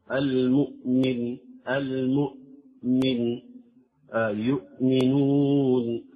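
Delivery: slow recited المؤمن and يؤمنون, clean catch on the hamza; measured tajweed model of المؤمن, يؤمنون; light hamza demo. Shown as a demonstration. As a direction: articulate the mid-word hamza in المؤمن lightly, stretching the syllables. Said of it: "خاصة إذا جاءت في وسط".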